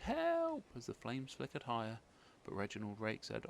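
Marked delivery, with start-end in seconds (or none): 0.0-0.6 very high, loud cry for help; frightened scream. Acted. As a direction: scream for help as flames rise. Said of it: "Betty screamed".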